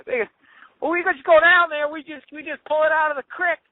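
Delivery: southern yokel bragging about local sushi; southern accent. Pretend. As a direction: play a rural Midwestern yokel with a southern accent. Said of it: "every moron in my act have a southern accent".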